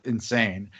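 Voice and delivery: usual speaking voice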